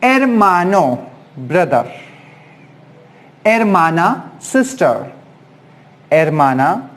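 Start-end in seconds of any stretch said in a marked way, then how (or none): 0.0-1.0 Hermano with no H sound, starting on the E; silent H. Shown as a demonstration. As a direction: drop the H in Hermano, say ermano. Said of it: "H is silent in Spanish".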